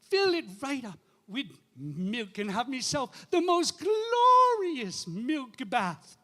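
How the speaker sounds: dreamy, relishing Irish woman; high voice, drawing out the big words with relish; Mother McCree. Acted. Irish woman confiding her dream to the priest, rapturous high voice. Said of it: "And she said".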